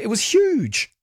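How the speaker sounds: "huge" in a high, far-off exclamation; emphatic huge. Real, not acted. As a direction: throw huge up high in wonder. Exaggerating old paper size.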